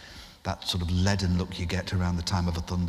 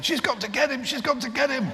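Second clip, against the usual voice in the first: high, thrilled chant; excited; 3 dB louder than usual. Retold or imitated. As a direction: bounce with glee.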